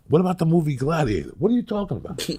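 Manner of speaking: high, quick, baffled exchange; acted incredulity. Dreamer asks about a movie and is dismissed, high and incredulous. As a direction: act out an urgent question and disbelieving retort. Acts out pleading and baffled replies.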